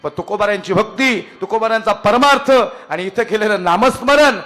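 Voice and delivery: fervent devotional sermon climax; preaching at full force. Acted. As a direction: drive the closing point home to the crowd.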